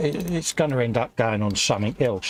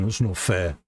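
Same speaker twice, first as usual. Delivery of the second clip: sulky whine; 7 semitones lower than usual. Retold or imitated. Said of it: "I'll be going".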